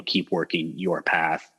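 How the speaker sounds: ordinary talk, nothing marked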